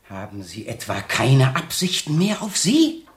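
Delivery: ordinary talk, nothing marked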